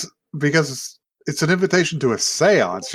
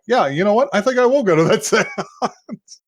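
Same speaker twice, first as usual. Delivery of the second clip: high-pitched imitation of a girl deciding; lightly mimicked, higher-pitched acceptance; acted change of mind; 7 semitones higher than usual. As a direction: voice her deciding, lifted high. Acting out her change of mind.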